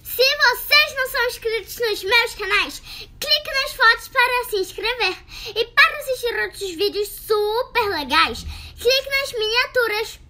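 high, loud, slow channel outro; YouTube outro voice. Acted. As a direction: bubbly kid host sign-off. Outro ad read.

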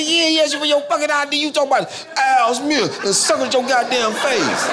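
high, fast, laughing scold; high, incredulous rant. Retold or imitated. Scolding Al about his job, high and exasperated. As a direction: tear into him in a squeaky rant. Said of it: "I said".

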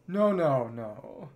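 ordinary delivery

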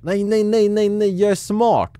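rapid-fire no's, high and loud; excited outburst. Real, not acted. Gamer suddenly spots the solution, excited outburst. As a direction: cut yourself off loudly with a burst of excitement.

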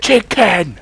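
high, loud yell; sudden squawked interruption; shouted chicken gag. Acted. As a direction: burst in with a high comic shout.

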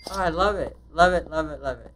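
real burst of approval; thrilled, punchy and loud; loud delight. Real, not acted. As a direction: exclaim it loudly with relish. Delighted that orders work out.

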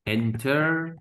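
"Enter" in two separated beats, held at the end; "Enter" measured and isolated; syllable build-up. Shown as a demonstration. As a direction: sound out "Enter" as a piece of a longer word. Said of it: "second syllable".

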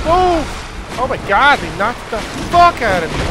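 shocked yell. Real, not acted. Knocked down by spiders.

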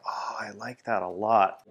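ordinary talk

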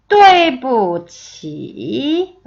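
对不起 very slow, sharp fall then a dip and rise; tone demonstration. Shown as a demonstration. Teacher modelling the whole phrase, 对不起 slow and toned. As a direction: hit 对, drop, then stretch 起 through its dip. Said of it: "다시 한번 들어보세요".

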